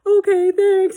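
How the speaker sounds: high, loud weepy squeak; mock tearful. Girl crying on the phone to the apologizer, tearful. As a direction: imitate a crying child giving thanks. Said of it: "like, crying".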